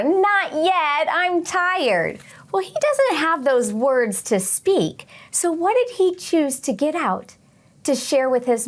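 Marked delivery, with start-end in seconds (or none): 0.0-2.2 a lightly voiced whiny sleepy kid, higher; whiny not yet. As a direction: complain like a kid who won't get up.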